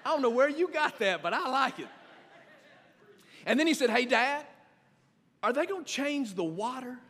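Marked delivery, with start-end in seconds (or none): 0.0-1.9 chuckling through the words; amused dad laughing as he talks. Real, not acted. Father laughing at son's idea. 3.9-7.0 curious son. Retold or imitated. Father voices son's question.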